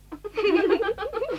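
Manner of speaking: put-on high girlish giggle. Schoolgirl giggling at the teacher's joke. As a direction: giggle behind a hand. Girls giggle at blotting paper.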